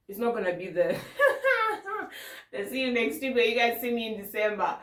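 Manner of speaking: laughing through the words. Real, not acted. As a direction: giggle while joking. Laughing at own joke.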